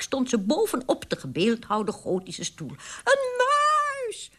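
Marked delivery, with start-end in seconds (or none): 3.0-4.3 very high, drawn-out shriek; screaming. A narrator's reading. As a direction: shriek in panic at the sight of a mouse. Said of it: "vrouw harder en harder schreeuwde".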